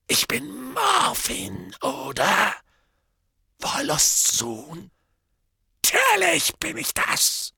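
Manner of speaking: high, cracked mad voice; a deranged drunken hermit; crazed drunk. Acted. Morphin's voice.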